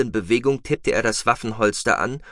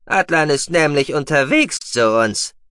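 high, loud creature voice; warning mousebeaver; 6 semitones higher, 6 dB louder than usual. A narrator's reading. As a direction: announce it high and forcefully. Guki goes on warning. (hohe, laute Mausbiberstimme, warnend)

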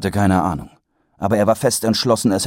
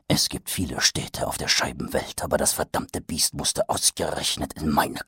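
low, softer, muttered; bitter hushed thought; 4 semitones lower, 6 dB quieter than usual. A narrator's reading. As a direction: mutter it in disbelief. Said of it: "dachte er".

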